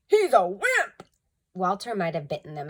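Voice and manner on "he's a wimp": higher, louder sneer; scornful burglar. A narrator's reading. Second burglar sneering at Walter. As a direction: dismiss the dog with contempt. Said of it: "said the other".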